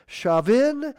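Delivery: "Shavin" said sha-VIN with a SH start; dialect pronunciation. Shown as a demonstration. Preacher listing Gaelic dialect variants, "Shavin" said sha-VIN. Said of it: "Other pronunciations that follow".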